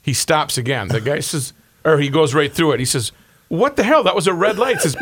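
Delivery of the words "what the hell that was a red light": alarmed. Retold or imitated. Passenger alarmed in joke.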